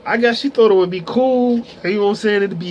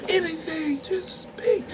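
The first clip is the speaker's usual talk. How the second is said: pitched high and hushed, near-pleading; desperate hushed plea; 8 semitones higher, 8 dB quieter, slower than usual. Retold or imitated. Pleading with his frozen friend while she can't see, high hushed plea. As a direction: beg him under your breath to say anything.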